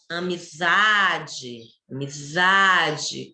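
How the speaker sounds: "amizade" drawn out slowly, weight on ZA; loud and slow. Shown as a demonstration. Teacher showing the stressed syllable, "amizade" slow with a strong ZA. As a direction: stretch the ZA in "amizade" so the stress shows. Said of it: "Quando eu falo ela alto e devagar".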